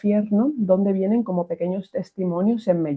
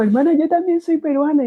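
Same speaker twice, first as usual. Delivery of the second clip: lightly voiced nun, higher and louder; a hint of a delighted nun, pitched up; excited nun; 9 semitones higher, 5 dB louder than usual. Nun telling the mother she is Peruvian too, mildly excited and higher.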